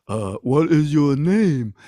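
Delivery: slow, loud dim giant; Cyclops voice. Acted. Cyclops asks Odysseus his name, slow giant voice. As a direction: lumber slowly through the question. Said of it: "Polyphemus looks at Odysseus and says".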